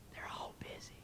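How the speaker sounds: tiny hushed whisper; whispering child. Acted. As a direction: whisper it secretly like a little kid. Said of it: "Still whispering, the voice said".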